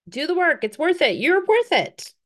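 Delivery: ordinary talk, nothing marked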